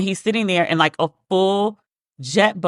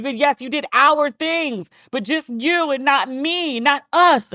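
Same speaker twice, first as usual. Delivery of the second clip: higher, slow, wounded mother complaining; a mother guilt-tripping her daughter, higher and slower; aggrieved mother; 7 semitones higher, slower than usual. Retold or imitated. Mother scolding in retold story.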